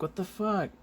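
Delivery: high-pitched mock bewilderment; Carnage. Carnage watching the beating, high incredulous voice. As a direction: squeal it in comic disbelief. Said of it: "Carnage is like".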